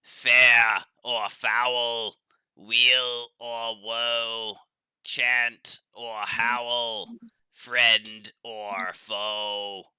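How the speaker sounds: slow, loud, strained goat voice; goat choking on sawdust voice. Acted. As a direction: drag each word out like a choking goat. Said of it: "sounds like a goat choking on sawdust".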